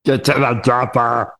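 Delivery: lightly done Schwarzenegger line; a quick Arnie growl; light Austrian movie-star accent; Arnie impression. Quoting Arnie film line.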